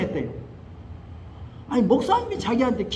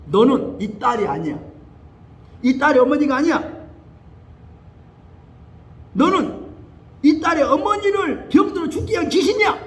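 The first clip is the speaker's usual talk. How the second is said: loud, rising exorcism command; shouting down the demon; rebuking shout; 5 semitones higher, 5 dB louder than usual. Retold or imitated. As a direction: cast the spirit out with force.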